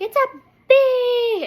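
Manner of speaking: high, loud, drawn-out wail; mock shriek, stretched out slowly; imitated child's shriek. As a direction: mimic a frightened daughter shrieking. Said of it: "my daughter shrieks very, very loudly".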